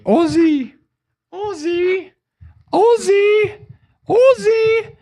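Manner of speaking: very high, drawn-out calling voice; high falsetto wifely call; Sharon Osbourne impression. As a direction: call for him in a piping, puppet-like voice. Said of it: "do your best Sharon Osbourne impression".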